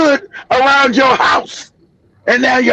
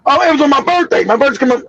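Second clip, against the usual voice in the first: normal talking voice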